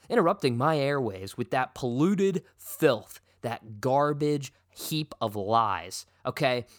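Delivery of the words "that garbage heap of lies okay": ordinary talk, nothing marked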